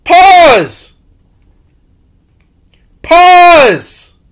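high, loud call to pause; loud shout. Real, not acted. Shouting at the video to pause. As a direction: bark it out to stop.

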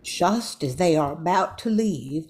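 ordinary talk

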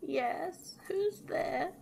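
high, soft granny call; old granny. A narrator's reading. Granny calls from inside the house, high and soft. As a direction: pitch it up, small and soft. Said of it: "came the voice of Granny inside".

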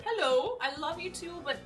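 usual speaking voice

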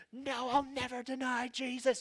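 high, bold hero voice; heroic vow. Pretend. Picturing ourselves as heroes, a bold high vow. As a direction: puff up like the hero.

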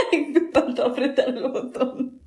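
words slowed by laughter; real laughter during the words; laughing. Real, not acted. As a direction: talk through the giggles. Laughing through a blooper.

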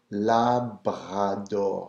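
slow Labrador with rolled French R. Shown as a demonstration. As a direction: roll the R's in Labrador slowly. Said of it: "So you're rolling the R".

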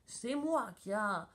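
indignant judge with swooping pitch; storming. A narrator's reading. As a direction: rage on, dragging out the shame. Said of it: "tempête le juge". (indignation tonnante du juge)

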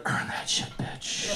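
hushed, voiceless whisper. Acted. Comic retells telling the stripper he tipped, whispered. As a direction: whisper it like a secret order.

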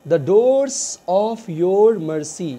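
very slow, each word drawn out; slow dictation. Shown as a demonstration. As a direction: spell it out slowly for learners. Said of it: "slow teaching read of translation". (बहुत धीरे, रुक-रुक कर)

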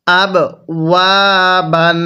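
"ওয়া" held long between short "আব" and "বান"; one-alif stretch. Shown as a demonstration. Teacher showing the alif rule, "ওয়া" drawn out one beat. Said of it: "ওয়াওয়ের মধ্যে আমরা এক আলিফ টান দিব".

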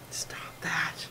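a sharp whispered telling-off; whispered stop. Real, not acted. Whispering at the cat pawing at her, sharp and hushed.

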